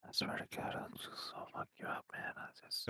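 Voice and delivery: very quiet, voiceless whisper; whispered mock threat. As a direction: whisper a playful threat under your breath. Mock threat under the breath.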